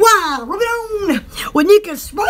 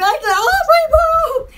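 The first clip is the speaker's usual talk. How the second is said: excited; 7 semitones higher, much slower than usual. Real, not acted. Spotting a rainbow.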